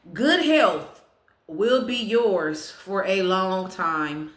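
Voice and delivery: slow read-out with a strong opening; ceremonious, drawn-out delivery; fortune proclamation. Real, not acted. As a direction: announce the fortune word by word. Reads fortune aloud to viewers.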